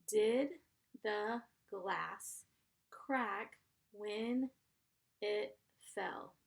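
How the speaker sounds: "Did" "the glass" "crack when" "it fell" split with pauses; slow dictation for young writers; word by word. Shown as a demonstration. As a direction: dictate it in chunks for spelling.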